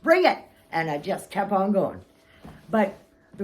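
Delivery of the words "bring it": loud, high, cocky taunt with a laugh; defiant taunt. Retold or imitated. Singer taunting the hecklers in the front row, loud and defiant. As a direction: dare them, rising to a shout on bring it. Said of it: "and I'm like".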